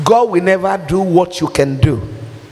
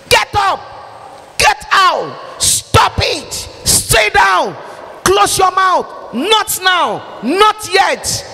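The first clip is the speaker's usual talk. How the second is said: parent shouting; 10 semitones higher than usual. Retold or imitated. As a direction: yell like a fed-up parent scolding their kids. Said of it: "The day you shouted on your son, on your daughter".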